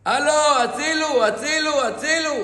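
high, loud calls of הצילו from a locked trunk; retold cries for help. Woman locked in the bus trunk screaming for help, loud high cries. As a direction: cry הצילו as if trapped and panicking. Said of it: "ואני צועקת, צועקת, צועקת".

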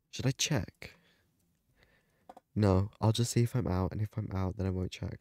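normal talking voice

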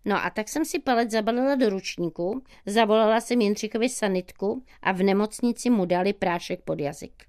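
plain reading voice

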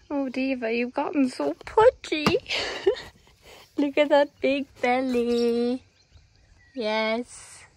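lightly cooing pet talk; talking to a horse. Real, not acted. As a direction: tease the horse fondly in pet voice. Teasing the horse about her belly.

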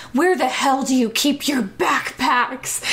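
a question pushed into a demand; demanding question, lightly acted; half asking, half demanding. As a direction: ask it like a mild order. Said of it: "he half asked, half demanded".

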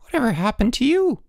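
high-pitched, startled woman; startled cry. A narrator's reading. Woman greets muddy boys at her door, startled cry. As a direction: cry out in shock, pitch leaping up. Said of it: "she cried".

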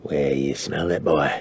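prowling villain coaxing his tracking companion; menacing intruder voice. Acted. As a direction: play a quiet, low-voiced hunter. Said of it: "you hear a voice go".

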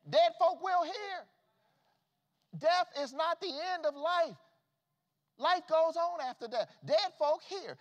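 loud, high preaching cry; preaching at full force. Acted. Preacher rousing the church about Lazarus, shouting. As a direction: whoop it to the congregation. Preacher proclaiming life after death.

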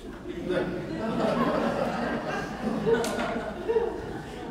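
a high, breathless laugh; real laugh; breathy laugh. Real, not acted. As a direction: laugh at your own punchline. Laughs at his own story.

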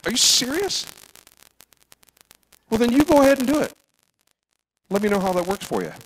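high, scoffing mock challenge; mock defiant churchgoer. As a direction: sneer it back like a know-it-all. Mocking a stubborn churchgoer.

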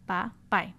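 "Pa, pai" said as two separate syllables with a pause between; split into syllables. Shown as a demonstration. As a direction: break "Pa, pai" apart syllable by syllable. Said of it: "formada de duas sílabas".

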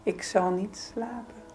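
ordinary talk, nothing marked

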